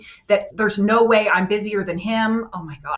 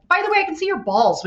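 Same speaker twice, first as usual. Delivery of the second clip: much higher, teasing tone; cheeky jab; 10 semitones higher, 3 dB louder than usual. Retold or imitated. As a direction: toss it off like a sly gotcha. Retold jab at professor.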